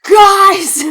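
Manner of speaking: high, loud laughing "Guys!"; sudden shouted group-chat announcement; mock excited call. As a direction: burst in calling to the group chat. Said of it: "all of a sudden be like".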